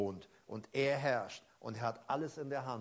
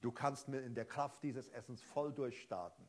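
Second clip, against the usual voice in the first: ordinary talk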